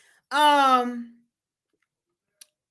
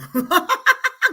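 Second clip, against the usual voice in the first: bright high burst of laughter; 10 semitones higher, 5 dB louder than usual. Real, not acted. Host laughing at Nora's comment, a high burst of laughter. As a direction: crack up at your own joke. Laughing at her own scolding.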